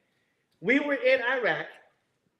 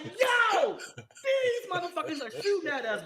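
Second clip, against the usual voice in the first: alarmed yell; 8 semitones higher than usual. Retold or imitated. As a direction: sound the alarm, all worked up. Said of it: "I'm like".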